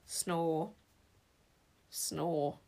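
low, soft snore; snoring. A narrator's reading. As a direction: snore sleepily. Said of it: "snoring loudly".

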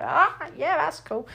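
high, thrilled little "ah, yeah"; impressed gasp. Retold or imitated. A viewer at a cinema stunt, lightly awed. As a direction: sound wowed by the stunt. Reacting to slow-motion stunt.

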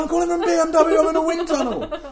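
high, loud put-on voice of a caller; mock salesman voice. Guy on the phone hams up a fake call, high and loud. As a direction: play the cold caller faking a posh sales pitch. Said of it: "you just hear him going".

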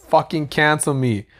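usual speaking voice

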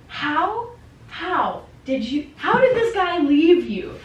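high, loud, drawn-out disbelief; mock astonishment.

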